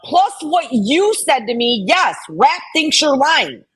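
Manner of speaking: indignant rant at an accuser; heated arguing. Real, not acted. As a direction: fire back at her in rising anger. Arguing with Southern Girl in chat.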